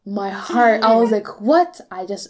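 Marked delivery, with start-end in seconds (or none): 1.4-1.8 high, loud burst of disbelief; retold shock, pitch leaping up on the question. Retells her shock at registration.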